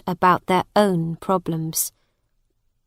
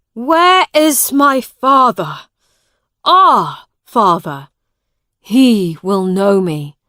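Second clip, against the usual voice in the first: louder, slower, urgent demand; loud insistence; 8 dB louder than usual. A narrator's reading. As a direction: call out for his father. Miles demands his father.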